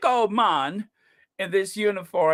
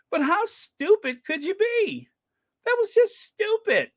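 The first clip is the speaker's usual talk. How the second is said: exasperated; 14 semitones higher than usual. Real, not acted. Exasperated rant at Andrew.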